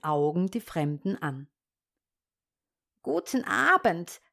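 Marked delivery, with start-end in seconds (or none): 3.0-4.2 höher, scheinfreundlich schnarrend; schnarrende Hexe. A narrator's reading. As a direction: wie eine Hexe, die Freundlichkeit vortäuscht. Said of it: "schnarrte sie und tat ganz freundlich". (höhere, schnarrende Hexenstimme)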